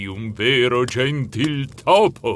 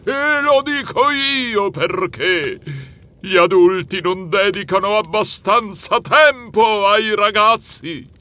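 shouting; 8 semitones higher, 5 dB louder than usual. Acted. Santa shouting at the mouse, high and loud. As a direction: cry it out, outraged. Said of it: "Lui gridò".

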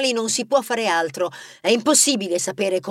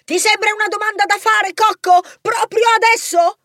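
much higher, louder shriek; 12 semitones higher, 4 dB louder than usual. Acted. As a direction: scold him with a shrill yell. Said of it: "Rebella strillò".

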